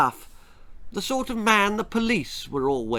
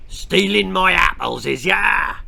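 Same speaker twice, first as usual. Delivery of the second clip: rough West Country farmer, louder; gruff farmer; 4 semitones higher, 5 dB louder than usual. A narrator's reading. Farmer catching children with his apples, gruff accusing. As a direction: accuse them like a surly farmer.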